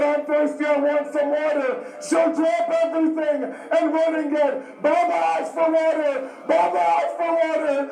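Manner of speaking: ordinary talk, nothing marked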